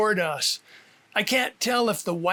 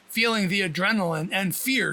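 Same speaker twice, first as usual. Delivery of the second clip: ordinary delivery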